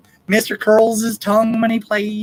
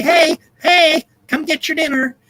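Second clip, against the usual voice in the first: higher, louder southern holler; southern accent; 7 semitones higher, 4 dB louder than usual. Acted. Caretaker waving a hard-of-hearing chimp over, southern accent. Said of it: "That was my southern accent".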